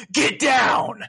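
high, forceful acted command; mock shouted warning. Masked soldier warning Raiden, mock shout. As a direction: call it out like a game soldier under fire.

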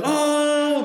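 imitating a very high "Oh" from the guys; mock teammates' oh. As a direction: squeal out the guys' oh. Teammates react to Tom.

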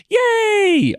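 high squealed yay; excited cheer. Real, not acted. Host cheering his podcast's milestone, a high excited yay. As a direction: cheer like a little party for listeners. Celebrating four hundred episodes.

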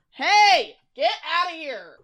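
high-pitched call away from the mic; real high scolding call; shooing pets away. Real, not acted. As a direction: shoo the animal off from a distance.

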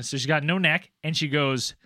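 ordinary talk

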